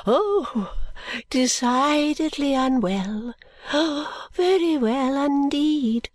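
put-on high, slow, secretive old lady; confidential old lady. Miss Flite confides in the guardian, high and hushed. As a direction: let them in on a secret, fussily. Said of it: "she said confidentially".

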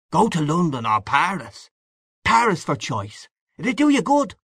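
high, swinging Irish bar-room voice; boisterous Irish man-about-town; hearty Irish old pal. A narrator's reading. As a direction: greet an old pal with breezy swagger. Same figure.

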